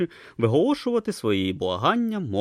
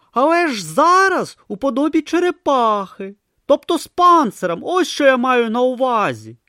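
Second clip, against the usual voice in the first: Brutha explaining; 8 dB louder than usual. Acted.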